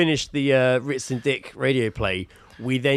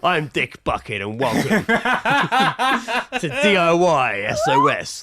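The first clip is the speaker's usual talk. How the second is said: slow laughing presenter intro; hammed-up TV host through laughter; 4 semitones higher than usual. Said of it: "TV show intro parody".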